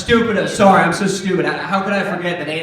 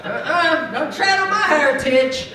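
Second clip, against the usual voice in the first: hillbilly cousin rant; 8 semitones higher than usual. Retold or imitated. Comic cousin complains his heritage is stolen, indignant hillbilly. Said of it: "He's like".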